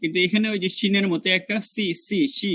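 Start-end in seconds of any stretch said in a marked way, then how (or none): 1.4-2.5 সি repeated with a sharp hissing s; si-si sound. Shown as a demonstration. Teacher showing how sin should sound, sharp repeated si. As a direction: isolate the s of সি. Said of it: "এরকম একটা শব্দ হবে".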